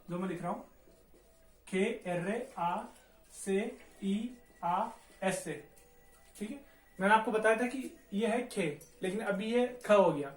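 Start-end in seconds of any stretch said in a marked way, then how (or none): 1.7-5.7 very slow, letter by letter; spelling out letters. Shown as a demonstration. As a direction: dictate each letter slowly with pauses. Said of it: "एक बार पढ़ना है इसको आप".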